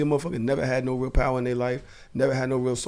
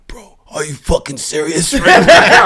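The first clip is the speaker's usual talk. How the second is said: slow whisper, breaking into laughs; giggly lost-voice whisper; laughing whisper; 4 semitones higher, 14 dB louder, slower than usual. Retold or imitated. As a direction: whisper in disbelief while laughing. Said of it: "You gonna make me scream".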